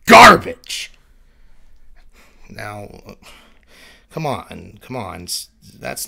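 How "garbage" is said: loud high shriek of contempt; screamed insult. Acted. Mirror self screaming an insult at Mars. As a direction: scream the last word.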